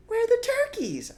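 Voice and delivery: small fox cub voice. A narrator's reading. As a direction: squeak it like a tiny eager cub.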